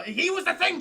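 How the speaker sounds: high, loud shout of revelation; hyped storyteller hitting the climax; excited reveal. Real, not acted. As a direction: blurt out the shocking twist.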